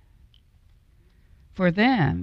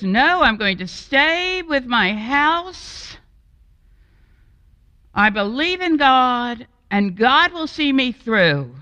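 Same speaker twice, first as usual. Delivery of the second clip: higher, louder pious refusal; stubborn refusal; 8 semitones higher, 4 dB louder than usual. Acted. Homeowner refusing the boatman, stubborn and pious. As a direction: dig in on your faith.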